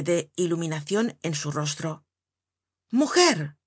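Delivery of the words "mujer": a sudden call, much higher and louder; exclaiming with sudden inspiration; shout. A narrator's reading. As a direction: burst out calling to his wife. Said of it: "gritó".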